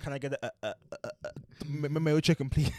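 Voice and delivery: stammering nervously over the order; awkward stammer. Retold or imitated. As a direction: trip over the words like an anxious customer. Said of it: "I'm fully stuttering".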